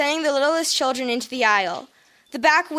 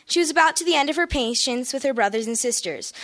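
usual speaking voice

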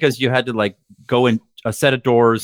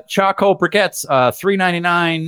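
slow, higher store-announcer call; Boston store announcer; 4 semitones higher than usual. Retold or imitated. Announcer calls out store specials, slow imitated Boston delivery. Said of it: "the guy would have the thickest Boston accent".

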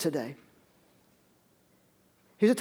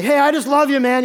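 loud, high, effusive thanks; hearty coworker; 6 semitones higher, 7 dB louder than usual. Acted. Coworker thanking you for getting them off early, hearty. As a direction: play a buddy gushing thanks, loud and raised.

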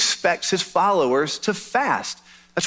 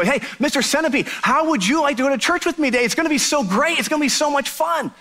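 higher, eager coaxing voice; encouraging; 9 semitones higher than usual. Acted. The lonely guy coaxing the centipede to church, higher and eager. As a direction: sell it like the best outing ever. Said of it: "maybe I need to encourage him".

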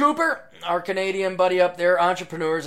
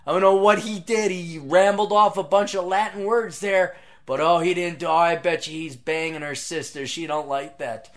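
mock gossip; 3 semitones higher, 6 dB louder than usual. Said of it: "It's like".

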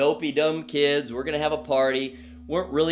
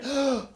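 sharp gasp; 10 semitones higher than usual. Retold or imitated. Air finally gets in.

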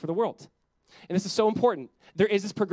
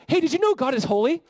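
high, loud, eager exclamation; excited cry; 8 semitones higher, 6 dB louder than usual. Acted. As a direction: tell it like thrilling news. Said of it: "they're crying out one to another".

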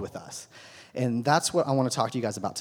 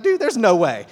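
mock disbelief; 6 semitones higher, 9 dB louder than usual. Acted reaction to hot plate.

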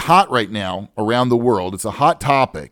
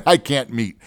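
normal talking voice

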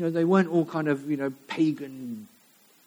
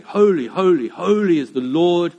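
loud, ringing proclamation, slowing to the end; antiphonal calling; 7 dB louder than usual. Acted. Seraphim calling to one another in the temple, calling out. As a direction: call it out in measured, emphatic phrases. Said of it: "this antiphonal calling".

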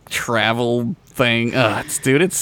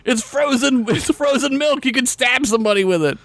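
a much higher, squeaky mock voice; 12 semitones higher than usual. Joking with a friend about airport rules, squeaky high mock voice.

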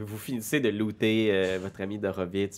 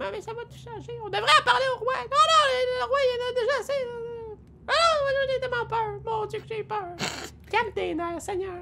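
very high, frantic, fearful chatter; panicked squeaky voices. Acted. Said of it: "des conversations un peu paniquées en faisant comme".

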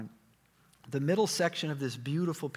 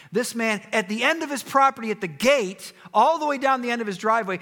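high, loud preacher's narration; projected parable storytelling; 9 semitones higher, 9 dB louder than usual. Acted. Preacher telling disciples' parable to a congregation, projected storytelling. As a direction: ring it out to the congregation.

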